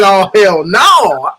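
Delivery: slow drawn-out shout of outrage; mock outraged shout. Retelling the outburst, slow and loud. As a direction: bellow it like a man who finally snapped. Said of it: "he said".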